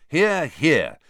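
loud, high cheering call. Acted. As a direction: cheer it to the hall. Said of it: "said Harry and Ron loudly".

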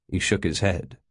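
ordinary delivery, nothing marked

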